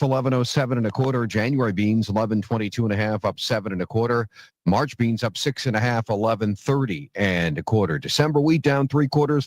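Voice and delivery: normal talking voice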